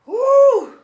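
a loud, high whoop of joy; excited whoop. Real, not acted. Singer cheering about last night's crowd with a high whoop. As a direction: let out a big, high cheer.

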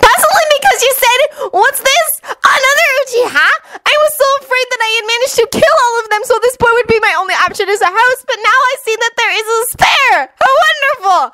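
very high-pitched, rapid-fire recital; helpful Naruto. A narrator's reading. Naruto helpfully quotes the villain back, high rapid voice. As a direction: rattle it off fast and way up high. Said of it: "Naruto told him helpfully".

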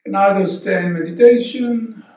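soft, unhurried breathwork guidance; hushed yoga teacher voice during practice; guided meditation. Real, not acted. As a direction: talk them softly through each breath, leaving long pauses.

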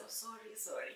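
very soft consoling apology; hushed, sympathetic little apology. Retold or imitated. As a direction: imitate classmates gently comforting someone. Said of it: "they were so sympathetic".

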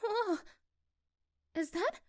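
sudden high "Oh" of surprise; surprised gasp. Acted. Girlfriend seeing a keychain appear, surprised high gasp. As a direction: caught off guard by the magic trick.

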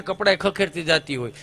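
normal talking voice

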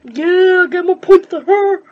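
high, loud sarcastic shout; frustrated yell. Real, not acted.

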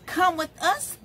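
ordinary delivery

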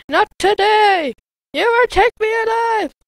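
high, loud hammed-up last stand; mock heroic defiance. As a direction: defy the monsters.

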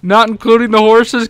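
ordinary delivery

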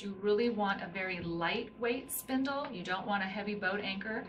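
ordinary talk, nothing marked

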